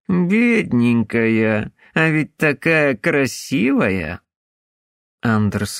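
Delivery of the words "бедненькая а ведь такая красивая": slow, mocking sarcastic pity; mock sympathy, drawn out. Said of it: "Бьорн сказал саркастически".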